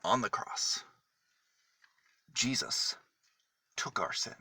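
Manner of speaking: usual speaking voice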